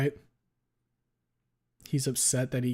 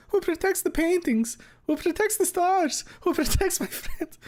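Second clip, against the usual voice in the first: Mario. Acted. Streamer reads Mario's plea from the fic, squeaky hammed-up distress. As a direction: squeal like a panicked cartoon plumber. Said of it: "I don't even know if I have a fucking Mario voice".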